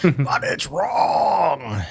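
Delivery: slow, whiny fake sob; mock crying whine. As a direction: wail it slowly like fake crying. Repeat of punchline, crying.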